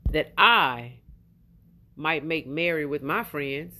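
put-on slow, low reading of the older son's complaint; scripture reading. Reads older son's words from Scripture.

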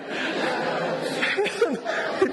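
breathless high laugh of disbelief; high airy laugh. Real, not acted. Laughs at the story.

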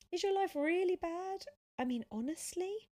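high, loud questioning; earnest challenge. A narrator's reading. Tom asking Sophia about her life, challenging.

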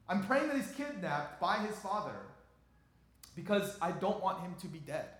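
high and loud, words tumbling out; agitated retelling. Real, not acted. Pastor telling the congregation about his frantic prayers, agitated. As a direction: relive the panic of waiting.

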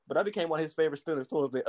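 ordinary talk, nothing marked

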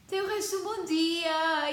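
high, sing-song imitation of a sweet lady; sweet nurse. Imitating the covid test nurse's cheerful goodbye, sung high. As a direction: chirp it like a kind nurse. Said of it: "e ela disse".

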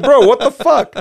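high, loud exasperated outburst, half laughing; angry. Retold or imitated. Ignored customer retelling his wait at a drive-in, comic anger. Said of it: "I was fucking angry".

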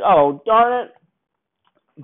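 high, loud and drawn out very slowly; mock dismay. Bond losing his ball in the rough, hammed-up high slow dismay. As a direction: stretch each word out in hammed-up disappointment. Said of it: "and Bond's like".

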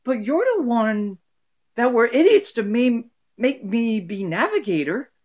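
a hurt, high Rose protesting; Rose inflection. Acted. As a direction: Rose answering Sophia's insult. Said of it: "Rose would end up probably responding".

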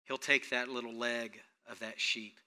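ordinary talk

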